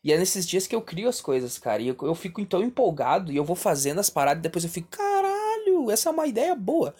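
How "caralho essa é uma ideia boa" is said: excited. Retold or imitated. Excited self-quote of own idea.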